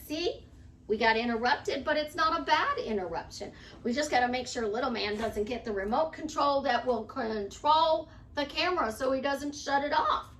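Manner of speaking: usual speaking voice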